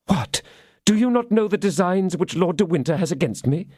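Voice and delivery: high, urgent question; can't believe he doesn't know; incredulous prisoner. Acted. Milady incredulous.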